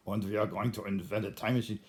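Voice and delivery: mock German accent. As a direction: imitate a German scientist's English. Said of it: "that German accent".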